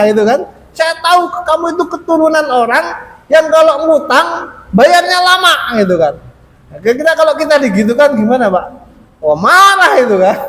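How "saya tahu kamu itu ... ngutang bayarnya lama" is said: insulting taunt. Retold or imitated. Creditor insults the Prophet's lineage, higher sneering voice. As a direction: sneer at his family. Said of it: "jelek-jelekan orang tuanya".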